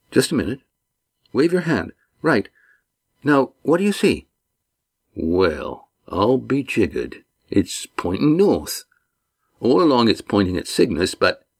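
lightly voiced rough sailor dawning on it; Walt, amazed sailor. A narrator's reading. Walt discovers the ring points.

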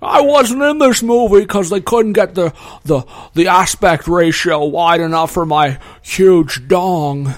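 Jimmy Stewart. Pretend. Actor explains absence from the movie, hammy impression. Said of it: "Jimmy Stewart, is that you?".

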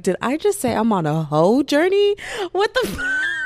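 loud, high, horrified disbelief; shocked at herself. Retold or imitated. Vlogger replaying her own slip, shocked and loud. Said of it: "Even I was like".

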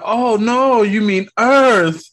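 scrambling to cover a lie; flustered Thor. Retold or imitated. Thor voiced in a retold scene.

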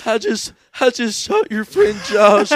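high, loud and slow grandpa impression; mock old grandpa. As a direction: play the proud old shooter. Grandpa voiced in retold story.

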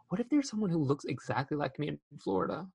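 soft, quick imagined musing; gasp, then wonder it quietly and fast; childlike wondering. Retold or imitated. Kid self thinking about a lookalike, wondering. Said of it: "I was like".

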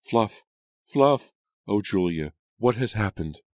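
high, distressed calling; alarmed cry. A narrator's reading. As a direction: cry out in alarm at the collapsed dog. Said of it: "Hannah cried".

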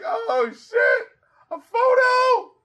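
high, loud, cracking excited yell; excited shout. Retold or imitated. Host reliving hearing the punchline, excited shout. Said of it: "I was like".

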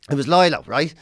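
ordinary talk, nothing marked

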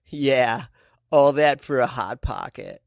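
put-on slow, drawn-out San Diego accent. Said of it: "let me do a San Diego accent".

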